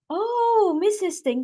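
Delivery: villager greeting. Retold or imitated. Villagers greet the old lady.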